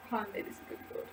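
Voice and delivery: whispered under her breath, hopeful; eager whisper. Retold or imitated. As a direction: hush it like a secret thrill. Thinking before the film.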